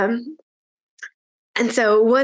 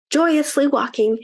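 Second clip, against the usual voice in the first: ordinary delivery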